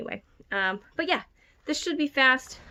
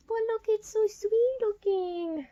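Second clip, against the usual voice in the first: higher, slow, cooing over the cover; cooing gush; 11 semitones higher, slower than usual. Real, not acted. Showing the cover to viewers, cooing higher and slow. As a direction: coo over it like something adorable.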